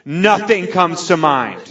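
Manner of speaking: high, slow, overblown gushing; acted ecstatic sobbing declaration; hammed-up drunken bawling. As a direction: proclaim it word by word in a mock-drunk wail. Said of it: "I am drunk. I am saying outlandish things".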